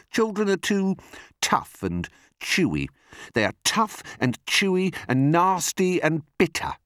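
high-swooping, disgusted complaint; peevish crocodile voice; fussy not-so-big crocodile. Acted. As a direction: list it with growing distaste.

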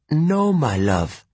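put-on high, slow Italian-accented refusal. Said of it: "She spoke with an Italian accent".

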